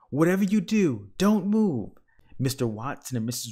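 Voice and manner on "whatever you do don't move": much higher, loud shout; frantic shout. A narrator's reading. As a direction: yell at them to freeze. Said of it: "shouted Mister Watson".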